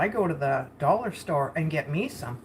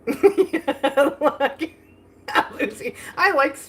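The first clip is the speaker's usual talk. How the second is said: bursts out laughing, higher and louder; real laugh spilling into the word; laughing at the typos on screen; 7 semitones higher, 5 dB louder, much slower than usual. Real, not acted.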